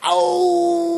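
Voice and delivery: high sharp dying yelp; death cry. Pretend. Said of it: "anyone die in Mortal Kombat".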